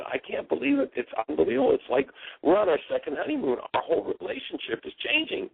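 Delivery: high, amazed retelling of the husband; thrilled husband voice; excited disbelief. Retold or imitated. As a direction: gush like a man who can't believe his luck. Husband excitedly reports marriage turnaround.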